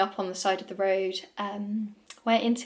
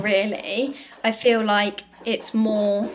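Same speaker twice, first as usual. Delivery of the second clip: ordinary talk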